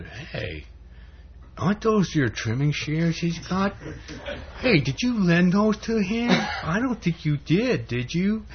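high-pitched needling devil; devil's voice. Acted. Devil asking Pink Panther about his trimming shears, devil's voice. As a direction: sow suspicion with high, teasing questions. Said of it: "the devil says to the Pink Panther".

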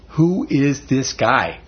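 slow, weighty question; solemn slow question. Shown as a demonstration. Preacher to congregation, slow weighty question. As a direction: let each word land slowly. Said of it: "I'm telling you".

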